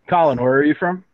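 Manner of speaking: usual speaking voice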